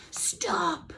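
high, sharp barked command; barking dog. A narrator's reading. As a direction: bark it like a dog. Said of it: "barked the dog".

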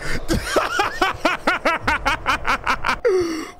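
high, long burst of laughter; cracking up. Real, not acted. Laughs at ending.

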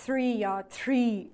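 "three" with th, then "tree" with a hard t, slowly; three versus tree. Shown as a demonstration. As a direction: set "three" against "tree".

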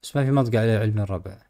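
usual speaking voice

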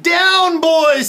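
high, loud cry; alarmed command. A narrator's reading. Danny to the climbing dinosaur, alarmed command. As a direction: call it down urgently.

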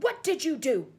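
furious bellowed question; bellowing. A narrator's reading. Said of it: "he bellowed".

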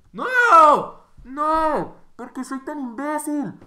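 high, very loud despairing scream; grito desesperado. Acted. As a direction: scream in over-the-top agony at your own blunder. Reenacts his reaction to losing. (grito agudo de desesperación)

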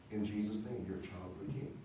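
normal talking voice

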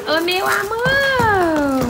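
slow, drawn-out delight at the mare; delighted pet talk. Real, not acted. Owner gushing slowly over her pregnant mare. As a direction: gush over her round belly.

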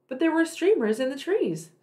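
plain reading voice

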